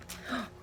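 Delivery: quick mock-scared gasp; playful gasp for the kids. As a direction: gasp as if startled. Shark page reveal.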